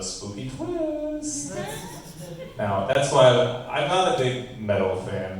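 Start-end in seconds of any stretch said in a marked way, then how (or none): 0.0-1.6 spooky. Pretend. Comic spooky emphasis.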